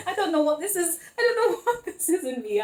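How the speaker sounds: high, giggly voice; laughing. Real, not acted. Reader amused at her own silliness, high laughing voice. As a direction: talk through a fit of giggles.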